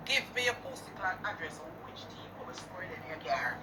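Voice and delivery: whispered menace at the rival; menacing whisper. Real, not acted. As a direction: hiss the threat quietly. Threatening rival under breath.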